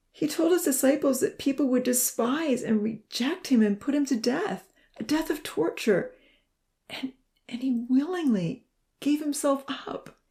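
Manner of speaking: high, halting, anguished testimony; strained, emotional and pitched high; choked out. A narrator's reading. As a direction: push the words out through tears. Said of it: "he choked out".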